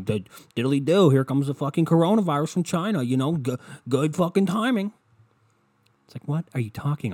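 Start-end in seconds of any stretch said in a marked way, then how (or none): 0.0-4.9 loud, high, sarcastic mock rant; escalating, incredulous impression; ranting conspiracy theorist. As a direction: imitate someone worked up over a theory. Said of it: "what they are going to say is gonna sound really dumb".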